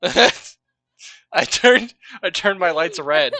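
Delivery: loud, laughing through the words; giggling while saying it. Real, not acted. Laughing about red lights.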